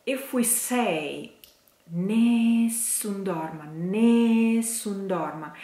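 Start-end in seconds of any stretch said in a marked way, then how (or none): 1.9-5.5 "nessun dorma" with the E of "nessun" held long; elongated vowel. Shown as a demonstration. Vocal coach demonstrating the stretched E in "nessun". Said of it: "We are just elongating one vowel".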